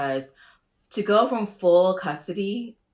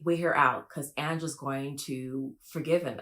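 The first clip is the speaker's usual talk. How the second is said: ordinary talk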